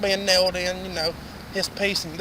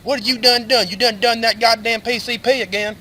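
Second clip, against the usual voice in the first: higher, louder exasperated telling-off; imitated angry scolding; 5 semitones higher, 6 dB louder than usual. Teresa scolds Randy about the drugs, acted angry scolding. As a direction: scold him in an acted raised voice.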